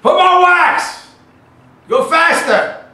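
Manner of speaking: loud high shout of an excited dad; yelling. Retold or imitated. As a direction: bellow it across the water. Dad yelling from boat, retold.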